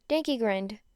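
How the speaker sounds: plain reading voice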